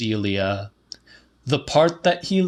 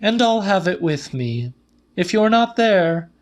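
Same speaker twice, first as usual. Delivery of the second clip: Celia's voice; 8 semitones higher, faster than usual. A narrator's reading. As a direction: build up high and insistent. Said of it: "said Celia".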